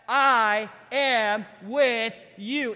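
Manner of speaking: slow, loud, each word weighted; proclaiming God's promise. Acted. Pastor proclaims God's promise to the church, slow and loud. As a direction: declare it like God's own promise.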